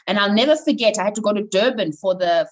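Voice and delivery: ordinary talk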